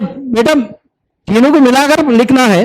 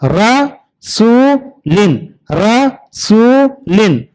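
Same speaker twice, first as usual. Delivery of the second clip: slow drawn-out demo; much slower than usual. Shown as a demonstration. Teacher drawing out रसूलिन slowly for children. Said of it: "तो यहां भी देखिए".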